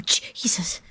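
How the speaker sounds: startled whispered Jesus; whispered gasp. Retold or imitated. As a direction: breathe it out in shock. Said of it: "they go".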